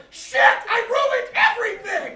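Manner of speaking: high, slow, loud cry of despair; mock-tragic wail at a pink shirt; panicked shout. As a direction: yell it like the laundry is ruined forever. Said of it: "You're like".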